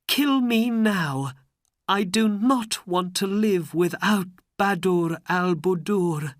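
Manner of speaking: a lover ready to die for his wife; tearful plea. Acted. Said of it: "There were tears in his eyes".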